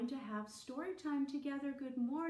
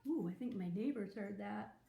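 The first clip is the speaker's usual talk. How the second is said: usual speaking voice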